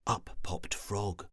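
usual speaking voice

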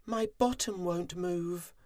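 very high, small voice; high-pitched, sheepish little bee; timid little bee. A narrator's reading. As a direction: confess meekly in a tiny high voice. Timid bee answers the queen.

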